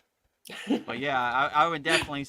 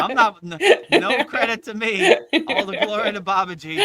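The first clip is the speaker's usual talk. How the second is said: loud, raised voice amid laughter; laughing protest; 6 semitones higher, 11 dB louder than usual. Real, not acted. Teacher refusing credit after a compliment, loud laughing protest. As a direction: wave off the praise, high and laughing.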